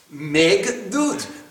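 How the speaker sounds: ordinary delivery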